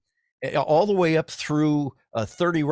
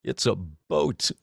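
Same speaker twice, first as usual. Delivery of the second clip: quoted line; 6 semitones lower, slower than usual. Retold or imitated. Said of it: "that line for".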